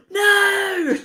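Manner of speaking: gasp, then a loud, high drawn-out "No!"; imitated freak-out. Retelling Gage's reaction to the scene, mock freak-out. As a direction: act out someone losing their mind, loud and high. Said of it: "Gage lost his mind over that one".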